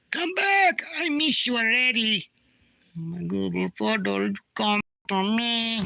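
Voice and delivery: high, hammed-up plea to the phone; mock pleading. Calling to his vanished Google photo, high mock longing. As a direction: beg the lost photo like a lost lover.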